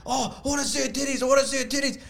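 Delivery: high, held-back mock yell. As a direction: imitate an overeager friend yelling, softly. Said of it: "you keep yelling like".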